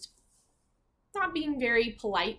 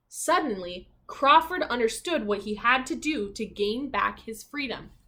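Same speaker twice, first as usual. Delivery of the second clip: lively, expressive storybook narration; reading to children; 5 dB louder than usual. A narrator's reading. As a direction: read it like a bedtime picture book.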